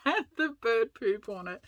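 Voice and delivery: words broken by real laughter; laughing. Real, not acted. Telling viewers about the stained page, real laughter. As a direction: laugh helplessly through the punchline. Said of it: "we can't stop laughing about it".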